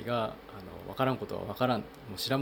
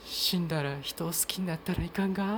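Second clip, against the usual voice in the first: higher, lightly voiced film heroine in Tosa dialect; film line in Tosa dialect; 8 semitones higher than usual. Podcaster recalling a line from the film Shikoku, lightly acted in Tosa dialect. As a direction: quote the film character gently, a bit higher, with a Tosa lilt. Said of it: "そのセリフで土佐弁が".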